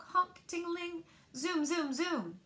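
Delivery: ordinary delivery, nothing marked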